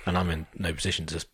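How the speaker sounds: normal talking voice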